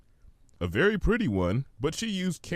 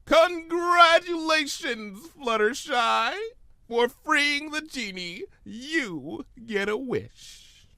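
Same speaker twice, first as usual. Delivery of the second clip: much higher, slow, booming proclamation; grand genie; slower than usual. Acted. Discord as genie proclaiming to Fluttershy, slow and grand. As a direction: announce it like a showman genie.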